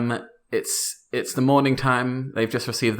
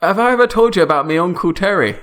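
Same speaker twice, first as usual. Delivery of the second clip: mock Canadian accent, a bit higher and louder; hammed-up Canadian friend greeting; 7 semitones higher, 7 dB louder, faster than usual. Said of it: "This is perfect Canadian accents".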